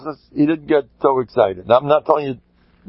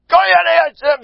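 horrified outcry, drawn out; anguished cry. Acted. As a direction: cry it out in horror. Footmen refuse, crying out.

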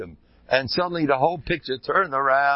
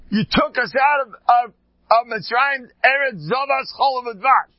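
whining complaint; 10 semitones higher, 4 dB louder than usual. Retold or imitated. Israelites complaining to Moshe.

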